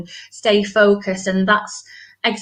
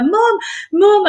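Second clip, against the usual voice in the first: put-on much higher, louder excited child calling out; 10 semitones higher, 4 dB louder than usual. Daughter running across the playground to mum, excitedly calling. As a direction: call out like a thrilled little girl. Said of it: "ran across the playground".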